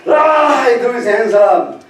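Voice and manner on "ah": short mock yell of frustration, higher and louder; frustrated yell. Man with a squirting waterbed, exasperated mock yell. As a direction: let out a fed-up cry as the bed leaks. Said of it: "and he's like".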